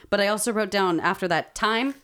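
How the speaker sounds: ordinary talk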